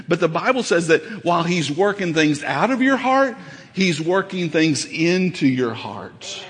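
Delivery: normal talking voice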